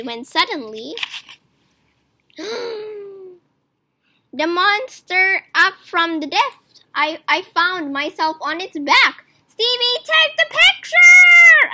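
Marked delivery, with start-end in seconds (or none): 2.4-3.1 sudden startled gasp; sharp gasp. A narrator's reading. Pushed into water, monster rises. 9.5-11.7 much higher, louder slowed screech; panicked screech. A narrator's reading. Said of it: "I screeched".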